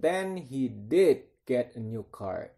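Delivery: slow reveal, punch "did"; stress on did. Shown as a demonstration. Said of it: "Ini did-nya ditekan".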